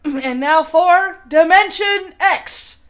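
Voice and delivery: slow, loud announcer read; hammed-up radio announcer intro; mock announcer. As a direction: clear the throat and announce it grandly. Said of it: "announcer voice".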